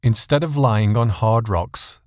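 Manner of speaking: ordinary delivery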